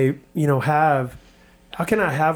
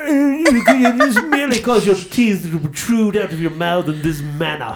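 high, hammed-up British accent through laughter; jokey fake British accent; mock British accent; 6 semitones higher, 3 dB louder than usual. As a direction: put on a silly posh British voice, teeth out. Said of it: "speaking in a British accent".

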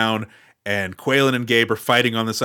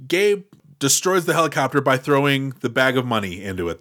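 ordinary talk

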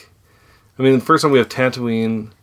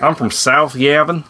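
mock Southern accent; 6 semitones higher, 3 dB louder than usual. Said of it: "it's like I'm from the South".

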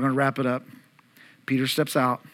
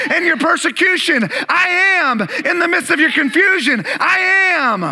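high, loud, sustained shout to a congregation; full-force preaching; 13 semitones higher, 8 dB louder than usual. Acted. Preacher telling a congregation Jesus is in their storm, shouted. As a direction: preach it at the top of your voice, driving each I AM home.

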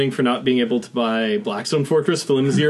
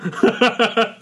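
stifled giggles; 8 semitones higher than usual. Real, not acted. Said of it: "Trying not to giggle".

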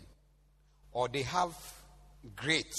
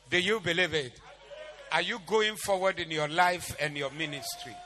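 rallying call; 4 semitones higher, 7 dB louder than usual. Real, not acted. Preacher rallies the congregation.

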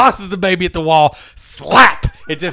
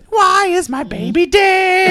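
high, slow announced title; movie trailer title; 13 semitones higher, slower than usual. Pretend. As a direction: present it like a grand film. Announcing a mock film title.

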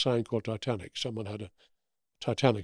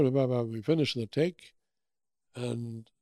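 ordinary talk

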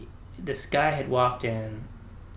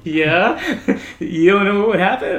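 mocking laugh; 7 semitones higher, 8 dB louder, slower than usual.

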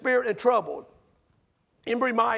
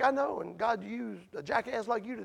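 soft, dry retort; understated sarcastic retort; wry comeback; 8 dB quieter than usual. Retold or imitated. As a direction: toss back the punchline softly. Comeback to coworker.

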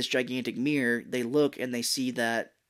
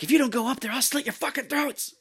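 high, screeched threat in a mock villain voice; threatening yell; 12 semitones higher than usual. [name] threatening the others upstairs, high mock yell.

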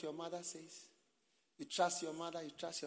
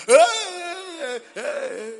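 loud, high put-on wailing; fake crying; 27 dB louder, slower than usual. Showing how people who are acting cry, noisy fake wail. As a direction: wail noisily like someone only acting at crying. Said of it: "People who are acting, they just".

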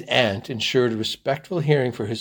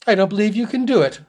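high, loud taunt; provocative teasing; 9 semitones higher, 7 dB louder, faster than usual. A narrator's reading. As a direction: goad him with a playful challenge. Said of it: "laughed Mary provocatively".

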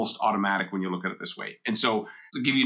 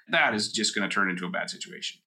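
usual speaking voice

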